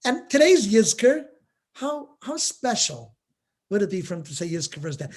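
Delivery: ordinary delivery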